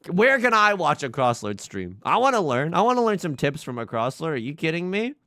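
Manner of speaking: usual speaking voice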